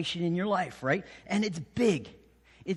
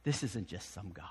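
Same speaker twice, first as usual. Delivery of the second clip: softer and lower, dawning dread; hushed realization; 9 semitones lower, 6 dB quieter than usual. Retold or imitated. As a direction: murmur it as it sinks in. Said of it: "they're like".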